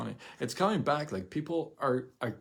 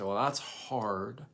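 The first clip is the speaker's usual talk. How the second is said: slow, defeated excuse-making; mildly whiny excuse; much slower than usual. Retold or imitated. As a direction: drag it out like giving up. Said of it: "You could say".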